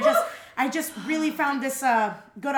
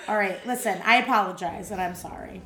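ordinary talk, nothing marked

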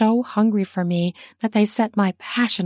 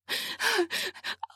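high sudden gasp; squealing gasp; 13 semitones higher, 11 dB quieter than usual. A narrator's reading. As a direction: squeal in surprise. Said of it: "I squealed".

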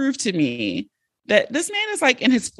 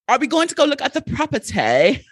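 put-on light British Valley girl accent, a bit higher; 6 semitones higher than usual. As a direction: mimic a British Valley girl asking about the property. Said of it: "the British Valley girl".

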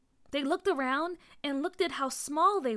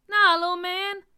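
emphatic refusal; 8 semitones higher, 10 dB louder than usual. A narrator's reading. Uncle Danny stops the child.

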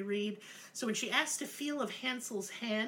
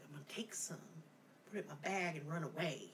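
low, much softer scheming voice; sneaky plan; 5 semitones lower, 11 dB quieter, faster than usual. Pretend. Narrator saying how she'd sneak off with the candy, low and soft. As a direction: murmur it like plotting a heist.

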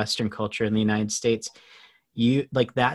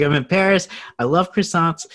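higher, louder, retold traveler's excitement; eager excitement; 6 semitones higher, 6 dB louder than usual. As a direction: relive the thrill of arriving. Retold eager arrival in Paris.

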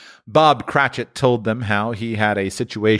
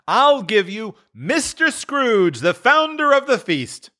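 higher, slow raised toast; Bob's toast; 13 semitones higher, slower than usual. A narrator's reading. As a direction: honour the absent employer. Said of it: "said Bob".

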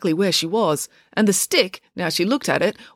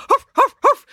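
much higher, louder dog huffing; playful dog barking happily; happy dog huffs; 8 dB louder than usual. Acted. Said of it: "said Pumpkin happily".

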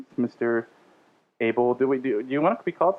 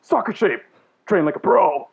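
punchy, louder radio pitchman tagline; 3 semitones higher, 4 dB louder, slower than usual. Pretend. Host closing the sponsor read in radio voice. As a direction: land the slogan like a wacky ad. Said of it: "my radio voice".

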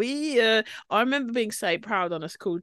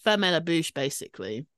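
normal talking voice

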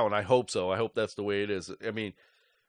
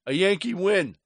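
higher, louder exclamation of disbelief; incredulous outburst; 8 semitones higher, 5 dB louder than usual. Real, not acted. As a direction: throw the words up in exasperated disbelief. Exasperated disbelief after Yankee win.

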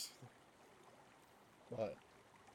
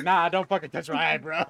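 louder, higher irritated brush-off; annoyed refusal; 3 semitones higher, 10 dB louder than usual. Retold or imitated. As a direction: snap back at them.

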